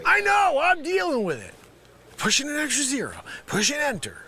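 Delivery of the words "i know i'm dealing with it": irritated holler over the shoulder; exasperated call-out. Acted. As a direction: shout back at nagging coworkers. Calls back to coworkers, annoyed.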